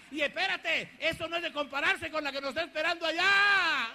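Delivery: full-voiced revival preaching; preaching at full force. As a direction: proclaim it to the whole church.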